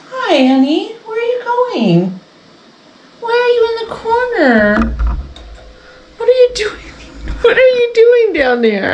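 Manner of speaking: high sing-song pet voice. Real, not acted. Mom coaxing her pet in a high voice. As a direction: coo up high at the dog.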